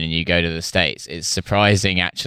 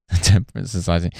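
ordinary talk, nothing marked

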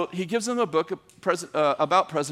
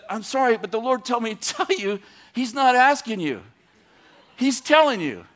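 louder, higher, pressing the point home; firm retold declaration; 5 semitones higher, 4 dB louder than usual. Preacher retelling how he told the man he had no choice, firm and loud. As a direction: speak it like a bold messenger. Retelling bold message to governor.

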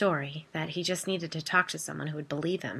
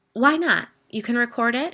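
louder and higher, insistent; eager push; 6 semitones higher, 8 dB louder than usual. Acted. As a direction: press her to come along. Biddy pushing eagerly.

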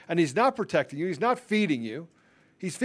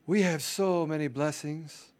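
slow, drawn-out pastor parody; mock sermon, stretching the words out; 3 semitones lower, 4 dB quieter, slower than usual. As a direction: put on a pious pastor to mock him. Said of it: "Let me be a pastor for a minute".

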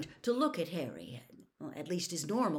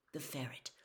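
ordinary delivery, nothing marked

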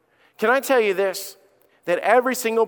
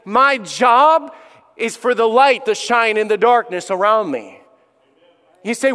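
louder, higher preaching; full-force preaching; 4 semitones higher, 4 dB louder than usual. Acted. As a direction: proclaim the testimony to the congregation. Preaching climax.

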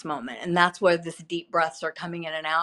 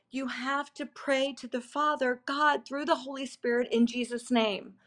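crying plea; 5 semitones higher, 4 dB quieter than usual. Retold or imitated. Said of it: "I'm repeating it and I'm crying".

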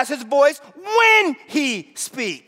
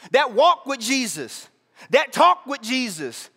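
usual speaking voice

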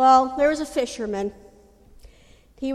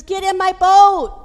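loud, high call over the storm; shouting from helicopter; 6 semitones higher, 9 dB louder than usual. Acted. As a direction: yell it down from the helicopter. Helicopter calls to drowning man.

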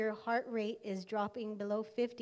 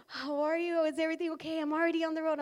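higher, anxious mother's voice; worried mom; 5 semitones higher, 3 dB louder, faster than usual. Retold or imitated. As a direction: voice the mom, rushing and concerned.

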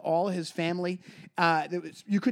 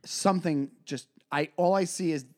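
usual speaking voice

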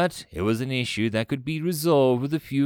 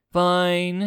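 loud, higher grudging growl; growled concession; 8 semitones higher, 5 dB louder than usual. A narrator's reading. Commander forced to settle for less, growling. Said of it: "he growled".

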